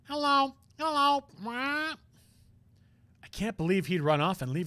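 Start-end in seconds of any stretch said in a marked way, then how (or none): 0.1-1.9 squawky, much higher parrot imitation; badly faked parrot squawk. Same parrot again.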